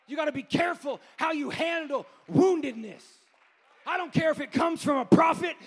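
loud, high, shouting sermon climax; full-force preaching. Acted. Preacher warning the congregation about woundedness, shouting and half-singing. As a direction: preach it at the top of your voice, almost singing.

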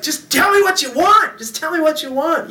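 exasperated high-pitched begging, acted out; pleading. Pleading with his crying baby.

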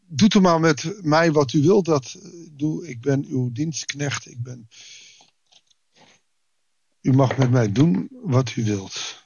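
quiet, fading into near silence; humble whispered-down prayer; surrendering prayer. Shown as a demonstration. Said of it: "Maar dit is een gebed".

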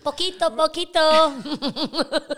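high, drawn-out mock-Spanish squeal. Host playing with Spanish, high silly voice. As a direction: pitch it high and stretch each word, laughing. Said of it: "This needs to be high".

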